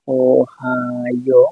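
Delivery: very slow, every syllable of Ohio stretched; slow dictation of Ohio, vowels held long; drawn-out sounding out. Shown as a demonstration. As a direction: stretch Ohio out as if writing along. Sounds out word while writing.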